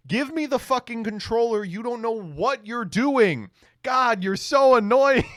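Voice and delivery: annoyed big brother yelling, high; snatch the controller, furious and loud; angry shout. Acted. Annoyed older brother grabbing controller.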